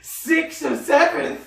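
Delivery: high, loud laughing shout; laughing disbelief. Retold or imitated. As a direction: crack up while telling him off. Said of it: "He goes".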